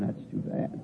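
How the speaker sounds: whispered aside. Retold or imitated. Preacher retells a quip.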